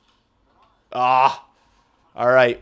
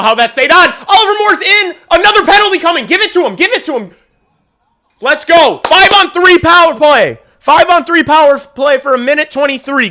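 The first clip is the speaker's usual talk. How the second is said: high, loud crying-out hype; excited shouting; 13 semitones higher, 9 dB louder than usual. Real, not acted. Fan hyped about a five on three, shouting. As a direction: yell it as the power play arrives. Penalties, power play coming.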